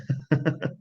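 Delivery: short breathy nervous chuckle; nervous laugh. Real, not acted. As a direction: laugh it off uneasily. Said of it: "It's more of a nervous laugh".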